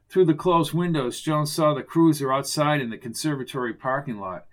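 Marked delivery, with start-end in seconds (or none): none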